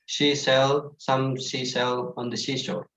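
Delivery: very slow, careful "seashell" twister; slow, deliberate tongue twister practice; tongue twister demo. Shown as a demonstration. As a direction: spell out "seashell" and "seashore" slowly. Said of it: "It's the tongue twister".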